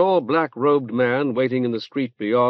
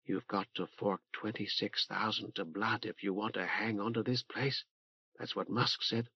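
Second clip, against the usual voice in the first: softly, low and confiding; lowered voice; 3 semitones lower, 14 dB quieter than usual. Acted. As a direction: lean in and drop your voice. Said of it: "lowered her voice".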